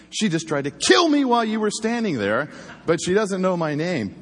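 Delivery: exasperated partner needling a frozen cop; sarcastic jab. Retold or imitated.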